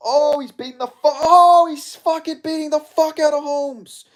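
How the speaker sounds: high-pitched frantic shouting; excited yelling. Real, not acted. Fan watching Ralston pound Holmes, high excited yelling. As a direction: yell in disbelief at the beating.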